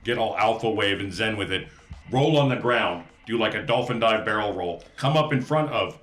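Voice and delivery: normal talking voice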